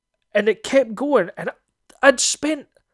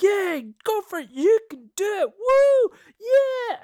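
mock cheerleading; 13 semitones higher, slower than usual. The wee kids cheering their king on, high and whooping. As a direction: cheer him on like a squealing fan.

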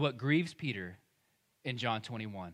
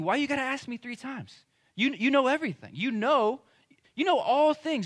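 high, loud, pleading exasperation; exasperated protest; 9 semitones higher, 5 dB louder than usual. Acted. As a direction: protest as a disciple asked too many times. Imagining Peter exasperated at repeated question.

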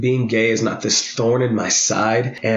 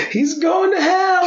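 mock hysterical mom; slower than usual. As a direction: ham up a panicked mother. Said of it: "roller coaster of like".

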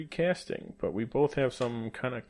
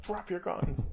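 mock command; 8 semitones higher, 4 dB quieter, slower than usual. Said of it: "It's like".

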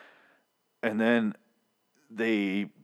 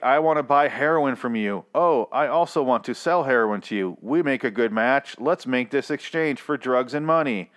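a touch higher, chirpy mock dealer swapping drugs; lightly voiced eager drug dealer; 5 semitones higher, 4 dB louder than usual. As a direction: play a cheerful dealer who is delighted it's legal now, lightly. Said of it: "you can just be like".

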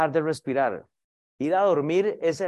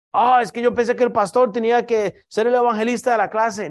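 excusa burlona; 5 semitones higher, 3 dB louder than usual. Retold or imitated. Cita burlona de un creyente excusándose.

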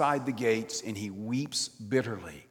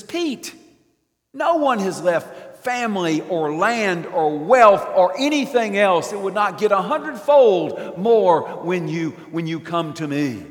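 Jesus' booming answer to Peter; Jesus declaring; 7 semitones higher, 10 dB louder than usual. Retold or imitated. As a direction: declare it with full force.

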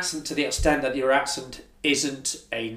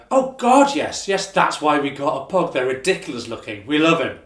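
gleeful agreement; 7 dB louder than usual. Acted. Friends cheerfully agreeing their pug is ugly, emphatic and gleeful. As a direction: gush like a doting pug owner.

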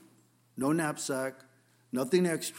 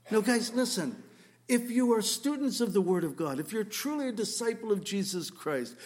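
usual speaking voice

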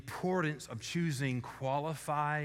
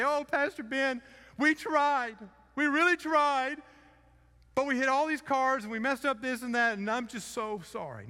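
higher, louder mock tearful plea; imitating crying child; 11 semitones higher, 6 dB louder than usual. Child to Pastor Ben, hammed-up tearful apology. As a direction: play the sobbing kid apologizing. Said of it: "they're crying and they say".

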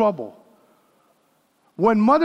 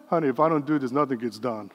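quiet wife's reply; 8 semitones lower, 6 dB quieter, faster than usual. Retold or imitated. Wife answers busy husband.

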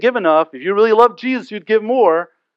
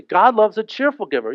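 ordinary delivery

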